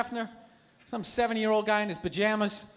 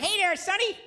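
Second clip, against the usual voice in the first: put-on old lady; 7 semitones higher than usual. Rich old lady hitting on him, high old-lady voice. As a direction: imitate an old lady making a pass. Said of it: "rich old lady came walking".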